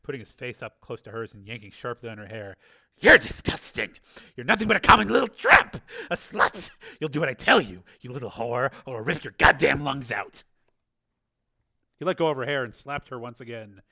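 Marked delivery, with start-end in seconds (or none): 3.0-10.4 loud, spat-out abuse; venomous hiss. Acted. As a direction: threaten her viciously, close to her face. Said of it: "Rico hissed".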